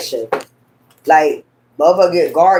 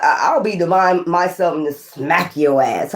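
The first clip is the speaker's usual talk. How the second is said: usual speaking voice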